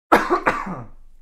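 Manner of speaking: loud choking cough. Real, not acted. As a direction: clear the throat after choking. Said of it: "went down the wrong pipe there".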